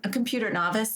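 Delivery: ordinary delivery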